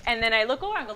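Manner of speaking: ordinary delivery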